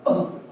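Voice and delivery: short breathy "uh" punched from the belly; quick unpitched belly grunt; diaphragm grunt. Shown as a demonstration. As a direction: let the air burst from the gut. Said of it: "you know, it'll come".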